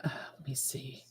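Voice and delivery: breathy whisper to herself. Real, not acted. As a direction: murmur as she scans the chat. Reading chat under breath.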